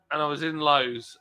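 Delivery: ordinary talk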